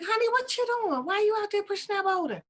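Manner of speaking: high-pitched mimicked wife voice; incredulous high spouse; puzzled wife. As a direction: question him in a high wifely pitch. Wife questions Paul in story.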